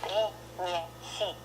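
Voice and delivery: при, не, си quietly, each softened and separate; soft syllable drill. Shown as a demonstration. Teacher demonstrates the softness to learners, soft syllables. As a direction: split при, не, си with tensed softness. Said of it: "you have this soft, softness".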